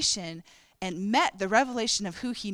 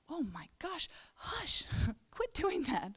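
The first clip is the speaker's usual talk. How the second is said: hushed scolding; 7 semitones higher, 8 dB quieter, slower than usual. Retold or imitated. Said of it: "the beggars around him are like".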